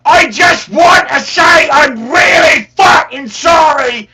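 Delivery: loud, high, hammed-up Cockney; Cockney accent. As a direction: shout a mock Cockney apology, drawing out the words. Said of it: "best Cockney accent".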